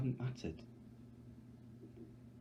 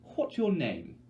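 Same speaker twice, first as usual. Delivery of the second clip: higher, louder bright question; chirpy hostess; cheery Effie; 8 semitones higher, 6 dB louder, slower than usual. Acted.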